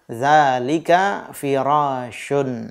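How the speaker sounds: dzalika firashun repeated slowly, falling; teacher repeating the answer slowly. Shown as a demonstration. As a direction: say it again very slowly.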